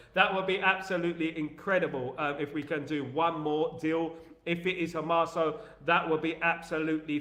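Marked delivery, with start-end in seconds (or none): none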